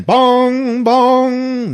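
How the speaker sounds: high, loud, ringing bongs; long, sung-out bell strokes; imitated clock chime. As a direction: toll like a big clock.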